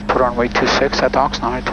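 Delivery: usual speaking voice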